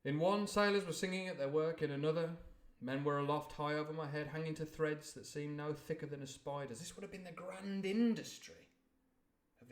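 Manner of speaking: story narration. A narrator's reading. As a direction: read aloud like a storybook. Reads novel passage aloud.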